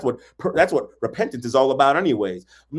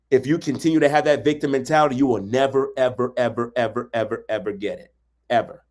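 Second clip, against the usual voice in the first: ordinary talk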